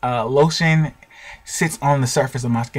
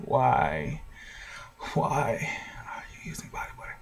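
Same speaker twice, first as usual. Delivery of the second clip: whisper; 14 dB quieter than usual. Pretend. Imagined viewer asking why.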